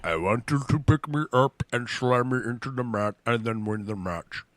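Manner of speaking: slurred, thick-tongued giant wrestler; big slurred wrestler impression; Andre the Giant. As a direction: mumble it like a huge foreign wrestler. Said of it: "they Andre the Giant versus Hulk Hogan".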